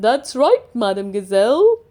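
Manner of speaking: lion grinning at the teacher. Acted. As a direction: say it with a grin.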